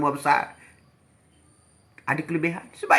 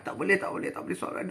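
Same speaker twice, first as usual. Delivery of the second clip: mock weak refusal; 10 dB quieter, faster than usual. Sick person refusing prayer.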